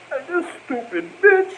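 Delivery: a high, cracking fed-up outburst; exasperated outburst. Retold or imitated. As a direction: blurt it out, stung and fed up. Reacting to being hit.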